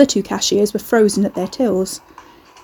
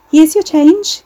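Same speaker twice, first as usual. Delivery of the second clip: bright cashier voice; 8 semitones higher, 7 dB louder, slower than usual. A narrator's reading. Cashier forcing polite cheer.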